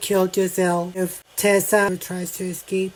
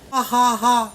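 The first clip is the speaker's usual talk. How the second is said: a retold mocking ha ha ha, pitched up and louder; imitated mocking laugh; 5 semitones higher, 5 dB louder than usual. Officer laughing at grandmother's complaint, imitated mocking laugh. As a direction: mimic his jeering laugh, a bit higher and louder. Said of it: "And then he starts laughing".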